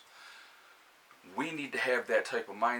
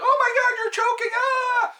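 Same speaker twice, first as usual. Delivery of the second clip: shrieking high, loud fake panic; mock panic; 16 dB louder than usual. Mocking a panicked friend at the table, shrieking high and loud. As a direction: squeal it like you've lost your head. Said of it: "You can't be like".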